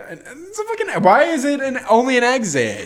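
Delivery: real frustration about a door that won't open; exasperated rant. Real, not acted. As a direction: pitch shoots way up in disbelief. Ranting about the exit door.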